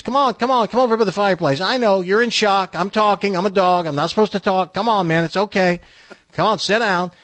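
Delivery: high, chatty dog coaxing a visitor; talking dog. Acted. Dog reassures the shocked visitor, talking-dog voice. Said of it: "the dog looks up and says".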